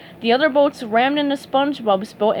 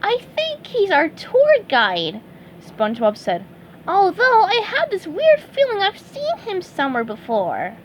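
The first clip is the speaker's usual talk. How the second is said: much higher cheerful character voice; SpongeBob; 9 semitones higher than usual. Acted. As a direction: wonder aloud.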